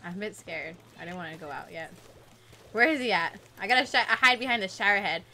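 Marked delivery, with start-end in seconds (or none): 0.0-1.9 hushed, nervous murmur; scared and quiet. Real, not acted. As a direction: keep it small so the sniper won't hear. Hiding from sniper in game.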